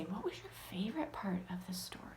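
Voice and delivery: put-on soft whisper to young readers; hushed question to little kids; whispered teacher question. Shown as a demonstration. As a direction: gently coax an answer. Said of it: "you might say".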